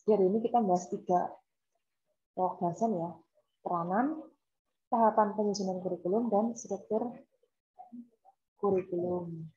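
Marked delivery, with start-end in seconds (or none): none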